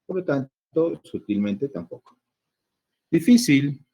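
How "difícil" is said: "Difícil" louder and higher, standing alone; raised, emphatic headword "Difícil"; emphatic announcement. Shown as a demonstration. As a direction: announce "Difícil" firmly as a new example. Teacher announces the next example word.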